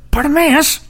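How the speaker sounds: high, exasperated hushing of a nuisance; annoyed crowd. Retold or imitated. Crowd hushing the blind beggar, high and exasperated. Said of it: "And the crowd's like".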